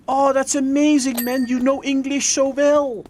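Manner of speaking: high, amazed mock voice; imitating amazed Dutchie. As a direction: gush with exaggerated amazement.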